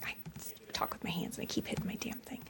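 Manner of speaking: very quiet, quick and whispery; muttered aside. Real, not acted. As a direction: murmur an apology off to the side. Aside about bumping the microphone.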